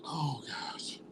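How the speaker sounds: choked-up whispered sigh. Real, not acted. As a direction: whisper it through tears. Choked up after crying.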